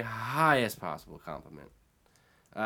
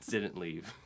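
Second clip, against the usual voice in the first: usual speaking voice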